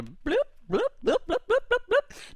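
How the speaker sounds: popping bloops. Acted. Sound of carrots growing.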